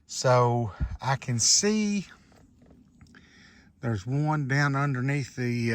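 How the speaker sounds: ordinary delivery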